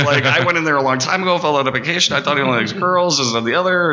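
high, hurried retold backpedal; flustered explaining. Would-be employee explains old rejection to partner, high and flustered.

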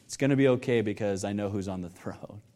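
low, soft, settled assurance; quiet reassurance. Acted. Preacher reassuring anxious worshippers before worship, low and soft. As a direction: bring it right down to soothe an anxious heart.

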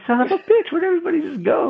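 exasperated surprise. Retold or imitated. Left alone in the group chat, high mock outrage. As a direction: throw up your hands at the empty chat. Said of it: "And I'm like".

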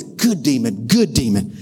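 low, pleased devil praising his imp. Acted. Devil to his demon, low and pleased. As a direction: purr low approval like the devil.

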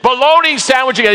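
high, loud grumble; hammed-up disgust at his lunch; ticked-off worker. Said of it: "He's just ticked".

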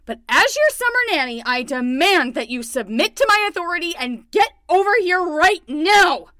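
high and loud, demanding then begging; insist, then plead; pleading command. Acted. Summer nanny demands Donnie come back, high and loud.

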